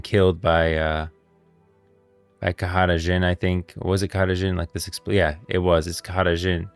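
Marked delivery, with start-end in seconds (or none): none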